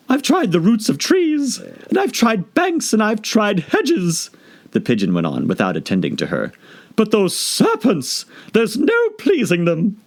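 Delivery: harassed bird listing its woes; fretful pigeon. Acted. Pigeon complaining to Alice, fretful.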